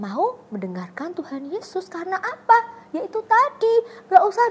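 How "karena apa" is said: usual speaking voice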